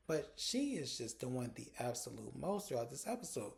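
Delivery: ordinary delivery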